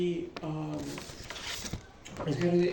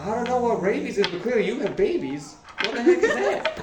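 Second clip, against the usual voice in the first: higher-pitched, climbing confusion; bewildered; 7 semitones higher, 5 dB louder than usual. Acted. Guild contact notices the party's baby, bewildered. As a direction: build to a loud, high, laughing question.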